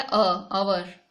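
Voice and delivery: "अवर" said with the silent h dropped; slow model of "अवर" with no h sound; pronunciation demo. Shown as a demonstration. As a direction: say "अवर" starting on the vowel, drawn out slowly. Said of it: "एच O यू आर".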